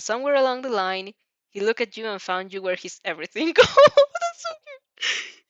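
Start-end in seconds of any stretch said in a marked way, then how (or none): 3.6-5.4 high, loud squeal melting into a slow, teary coo; real gush over the sweet moment; squealing at cuteness. Real, not acted. Reader gushing to listeners over a sweet moment, squealing.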